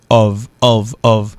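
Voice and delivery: ov ending on a voiced v, repeated; ov, each one set apart; pronunciation demo. Shown as a demonstration. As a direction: say ov with a buzzing v. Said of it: "O F prononcé comme O V".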